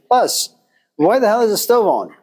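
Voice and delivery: sudden high, indignant outburst; mock-outraged squawk of complaint; indignant chicken. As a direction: protest like a chicken being boiled. Chicken complains about hot water.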